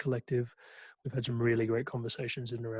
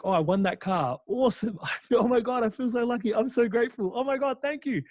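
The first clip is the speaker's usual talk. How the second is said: much higher, louder, gushing joy; mock delight; 9 semitones higher, 4 dB louder than usual. Speaker acting out a lucky winner's thrilled gratitude. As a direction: gush like someone who just won a car.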